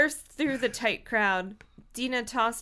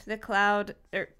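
ordinary delivery, nothing marked

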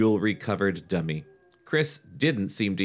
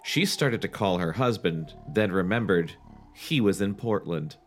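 ordinary talk, nothing marked

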